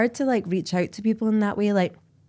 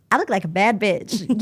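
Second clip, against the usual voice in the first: sassy; 2 semitones higher than usual. Retold or imitated. Imagined self-talk watching podcast.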